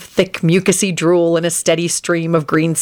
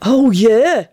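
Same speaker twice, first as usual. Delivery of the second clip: raised, eager agreement; fervent agreement; 5 semitones higher, 3 dB louder than usual. A narrator's reading. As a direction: agree with real fervour. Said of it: "Benji agreed fervently".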